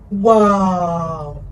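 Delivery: stunned by newfound internet; gasp of awe. Retold or imitated. Teen discovering the internet, gasp and loud wow.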